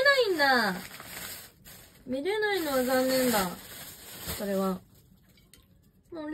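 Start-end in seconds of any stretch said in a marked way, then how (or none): none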